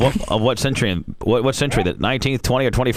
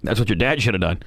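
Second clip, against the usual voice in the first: ordinary talk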